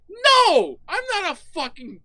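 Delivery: mock outraged high voice; high-pitched protest. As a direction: voice leaps high on a loud no. Acted protest at the suggestion.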